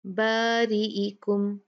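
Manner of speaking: बारीइकुम drawn out slowly with a thin light alif; slow thin recitation. Shown as a demonstration. Teacher shows students the thin alif. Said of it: "तो हम कैसे पढ़ेंगे?".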